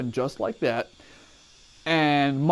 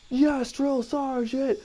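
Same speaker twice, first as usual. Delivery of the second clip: barked military recruit reply; 11 semitones higher, much slower than usual. Retold or imitated. Recruit answering drill sergeant.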